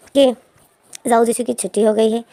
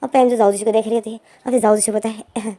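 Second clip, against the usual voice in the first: usual speaking voice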